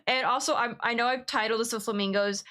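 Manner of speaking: normal talking voice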